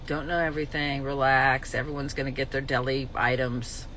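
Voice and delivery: low, soft and calm; calm self-talk. Real, not acted. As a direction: soothe yourself to relax. Calming self-talk, play dead.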